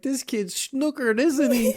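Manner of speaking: very high, slow amused voice; mock tipsy Barb. Barb remarks to the dude about the kid, mock delight. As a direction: put on a high, drawn-out party lady. Imitating Barb giving child wine.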